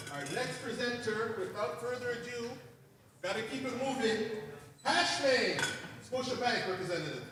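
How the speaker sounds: high, building MC introduction; hype announcer introduction. Real, not acted. MC calling up the next presenter for applause, hyped announcer. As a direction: bring the presenter on, rising to a loud cheer.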